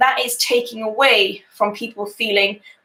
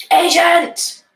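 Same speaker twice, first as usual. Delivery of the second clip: higher, louder desperate cry of the word; scream; 8 semitones higher, 5 dB louder than usual. Acted. Narrator screams at phone robot to get a person, loud cry. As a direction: yell it at the machine in frustration. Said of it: "I scream".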